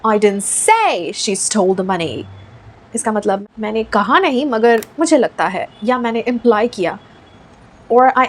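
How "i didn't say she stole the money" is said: slow demo sentence, emphasis on a stretched "say"; stress on SAY. Shown as a demonstration. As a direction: lean hard on the word "say". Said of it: "डालते हैं stress से में".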